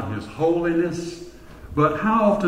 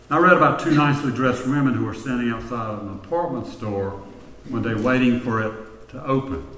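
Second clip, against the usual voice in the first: normal talking voice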